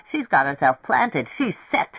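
plain reading voice

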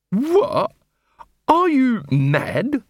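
high, drawn-out incredulity; outraged disbelief. Acted. Captain reacting to being relieved of command, outraged. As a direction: pitch shooting up in outrage.

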